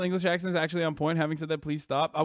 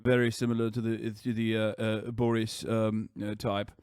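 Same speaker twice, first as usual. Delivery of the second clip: low, hesitant upper-class English impression; Jacob Rees-Mogg; 5 semitones lower than usual. Streamer impersonating Jacob Rees-Mogg on request, low halting voice. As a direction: murmur pompously, with plenty of ums. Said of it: "Can you do a Jacob Rees-Mogg".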